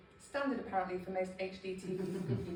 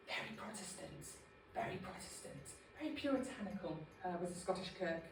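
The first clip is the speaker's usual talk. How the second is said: mock solemn whisper; 2 semitones lower, 9 dB quieter, faster than usual. Mock stern church whisper.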